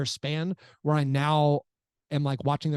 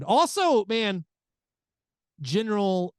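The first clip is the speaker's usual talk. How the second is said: excited; 6 semitones higher, 3 dB louder, slower than usual. Real, not acted. Sudden excited topic shift.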